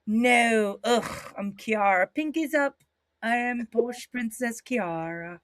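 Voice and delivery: put-on posh princess heel in British accent; Kiara. Imitating wrestler playing posh heel, happy British princess. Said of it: "she's happy and she's a heel".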